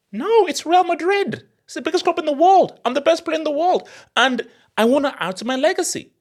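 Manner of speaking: high, emphatic player's protest; high-voiced ambitious star player; insistent footballer.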